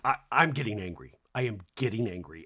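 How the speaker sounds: low, controlled imitation of a cross mother; mad mom warning. Mom putting fear into her kid, low mock warning. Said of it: "my mom when she would get mad".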